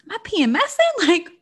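high, loud panicky self-quote, fast on P M S ing; panicked inner voice. Retold or imitated. Cohost retelling her own mood swings in a panicky voice. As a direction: squeal it like you're freaking out. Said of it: "And I'm like".

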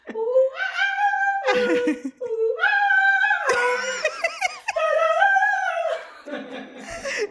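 long loud shrieking laughter; real laughing fit. Real, not acted. Friend cracks up at the Laval joke, shrieking laughter. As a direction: lose it completely, laughing and shouting.

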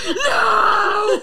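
long, high, loud wail; mock anguished shout. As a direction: scream it out, half laughing. Retells anguished reaction to picture.

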